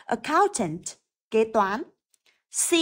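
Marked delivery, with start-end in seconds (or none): none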